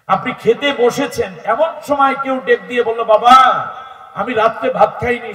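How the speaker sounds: loud, high-pitched exhortation to the congregation; full-force preaching. Real, not acted. Preacher urging listeners to share their meal, full-force preaching.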